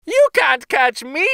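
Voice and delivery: high, loud, gleeful taunt; sing-song jeer at his chasers; gingerbread boy taunting shout. A narrator's reading. Runaway cookie shouting back at old couple, high gleeful taunt. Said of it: "shouted across to him".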